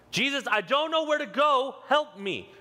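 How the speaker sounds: high-pitched, pleading cry; desperate plea. Acted. Lost believer calling Jesus for help, desperate plea.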